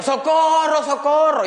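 high, loud shout for help; cry for help. Retold or imitated. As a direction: yell it as if drowning. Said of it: "Sino que hubiera dicho". (grito fuerte y agudo pidiendo socorro)

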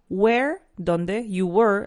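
"Where" and "were" said slowly and clearly apart; slow, clear "were" after "Where"; careful where and were. Shown as a demonstration. Teacher spells out "Where" and "were" slowly. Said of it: "Ojo con eso".

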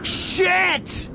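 high yelped curse; sudden high exclamation; startled curse. Retold or imitated. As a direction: blurt it as the storm hits. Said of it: "tornado scene quote".

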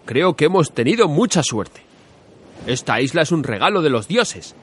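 upbeat captain rallying the crew; rally the men, pleased and lively; cheerful Ulysses. Acted. Ulises cheers his men. (capitán alegre y animado)

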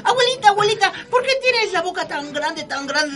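put-on high girlish pleading question; little girl asking. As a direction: ask like a small girl. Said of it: "y otra vez".

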